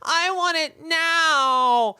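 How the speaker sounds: high, loud, crying whine; bratty tantrum. Retold or imitated. Mocking Musk yelling at staff like a toddler, loud crying whine. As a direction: wail it like a spoilt kid stamping his feet. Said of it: "He's like".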